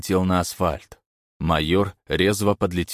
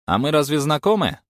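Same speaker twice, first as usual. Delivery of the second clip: higher, wary character voice; suspicious stranger voice; 9 semitones higher, 5 dB louder than usual. A narrator's reading. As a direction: put on a guarded stranger.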